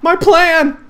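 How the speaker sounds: very high, loud yelp; outcry. Real, not acted. Gamer yelping as his plan fails.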